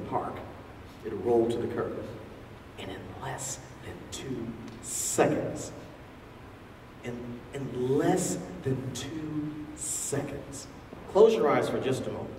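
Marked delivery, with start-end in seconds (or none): 2.8-5.7 hushed whisper. Acted. Dramatic retelling of the shooting. 7.0-10.7 slow, soft, drawn-out repeat; slow hush. Acted. Dramatic retelling of the shooting.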